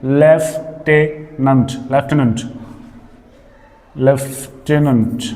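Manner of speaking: "लेफ्टिनेंट" stretched out as LEF-ti-nent; slow lieutenant demo. Shown as a demonstration. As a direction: model "लेफ्टिनेंट" slowly for the class. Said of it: "मुंह से मैं जिस तरह से बोल रहा हूँ".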